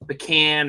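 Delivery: pecan with "can" as in tin can; pecan as puh-KAN. Shown as a demonstration. Host showing a Yankee version he rejects, pecan as puh-KAN. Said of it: "you sound like a Yankee".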